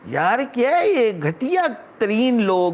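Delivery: slow, high-pitched contempt; disgusted outburst. Real, not acted. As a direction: draw out the insult in exasperation. Denounces those who laughed. (حقارت سے کھینچ کر)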